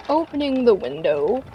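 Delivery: usual speaking voice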